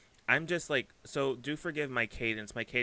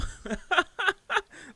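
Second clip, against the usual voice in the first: high delighted laugh; 5 dB louder than usual. Real, not acted. Delighted by the reading.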